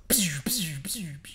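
whispered echoing pshh sounds; imitated ice echo. Describing the rock skipping on the lake, imitating its echo in a whisper. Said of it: "the reverb is just like".

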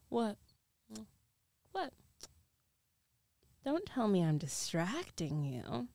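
ordinary talk

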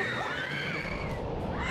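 breathless high shriek of laughter. Real, not acted. Ride drops suddenly, a breathless shrieking laugh. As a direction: squeal with unvoiced laughter.

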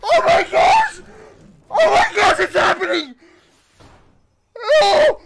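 high, loud shrieks of disbelief; real excited shouting. Real, not acted. Fan watching the reveal happen, excited shouting. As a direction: shout it as your dream comes true. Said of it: "I'm so excited!".

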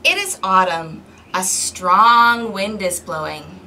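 storybook read-aloud, clear and lively; reading to children. Acted. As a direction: read it to the kids like a picture book. Reading picture book to children.

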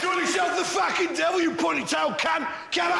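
high-pitched London heckle at the devil; mock-belligerent high shout; defiant Londoners. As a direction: taunt the devil like a cocky Londoner. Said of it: "we're all thinking".

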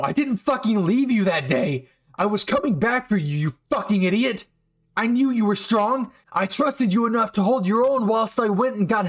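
heated defence. Acted. Bakugo telling Eijiro he never abandoned him, angry. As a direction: yell it out, desperate to be believed.

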